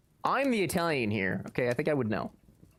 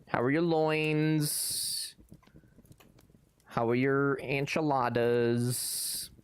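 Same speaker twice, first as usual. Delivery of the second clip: mock suggestive; much slower than usual. Real, not acted. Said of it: "feels more graphic".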